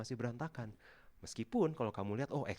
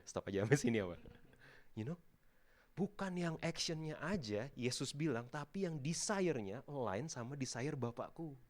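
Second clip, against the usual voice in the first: ordinary talk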